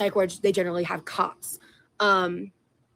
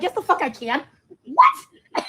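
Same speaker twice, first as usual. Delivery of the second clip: defiant retort; 8 semitones higher, 3 dB louder, slower than usual. Retold or imitated. Retold comeback to nurse.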